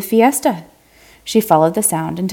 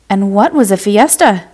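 ordinary delivery

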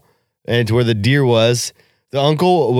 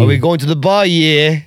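higher, hammed-up British hooligan; mock Brit accent; 4 semitones higher than usual. British lad heading to the bar, mock accent. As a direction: put on a rowdy British accent. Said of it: "you were doing the Brit accent".

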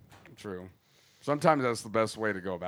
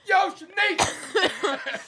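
gruff impatient scolding; much slower than usual. Retold or imitated. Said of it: "baby daddies would come in".

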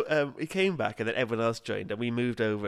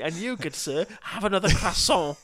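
grand, raised-pitch parting line; mock-theatrical address, building louder; Christopher Biggins; 5 semitones higher, 4 dB louder than usual. Friend retelling Biggins singling him out on leaving, theatrical flourish. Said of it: "he pointed at me, he went".

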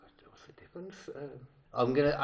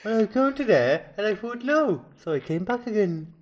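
higher, lilting attempt at a Welsh accent; 7 semitones higher, 6 dB louder, slower than usual. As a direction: stretch it out in a sing-song Welsh accent.

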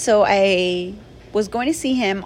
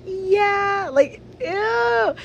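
awkward, cringing yeah; 12 semitones higher, much slower than usual. Retold or imitated. Awkward reply to name call.

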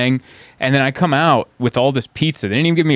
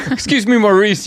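prissy polite; 10 semitones higher, 3 dB louder than usual. Pretend. Prissy voice to dealer.

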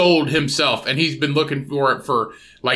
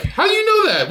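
very high astonished squeal; high hammed-up shock; faster than usual. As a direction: shriek it in mock amazement. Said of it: "[name]'s like".

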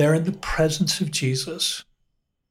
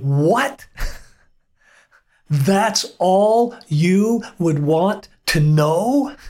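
higher, slow amused disbelief with a laugh; incredulous doctor; 3 semitones higher, much slower than usual. Retold or imitated. A therapist laughing in disbelief at his client's question. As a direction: lean in and ask it like you can't believe it.

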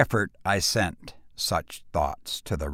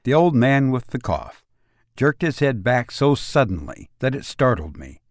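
ordinary delivery

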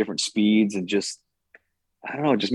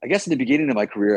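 normal talking voice

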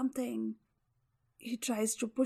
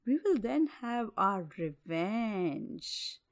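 scheming tiger; 2 semitones higher, 4 dB louder than usual. Acted. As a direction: coax the bear slyly. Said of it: "The tiger thinks he has a chance".

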